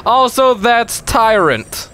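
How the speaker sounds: high, slow, stretched announcer delivery; hammed-up pitchman. Host wraps up fake console advert, overblown announcer. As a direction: keep selling with huge enthusiasm.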